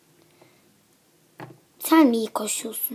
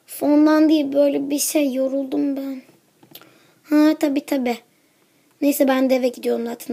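ordinary talk, nothing marked

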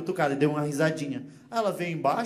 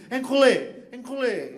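mock French insult; 5 semitones higher, slower than usual. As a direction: spit it out like a Frenchman. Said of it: "Você vai ver o francês". (xingamento em francês)

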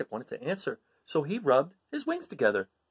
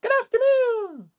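very high, slow, loud screech; long piercing insect screech; screeching cicada; 7 dB louder, slower than usual. Acted. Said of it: "screeched a cicada".

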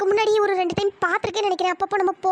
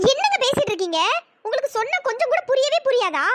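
high-pitched, indignant scolding; fed-up, affronted protest; exasperated; 5 semitones higher than usual. Acted. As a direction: scold someone who will not listen. Girl fed up with suitor.

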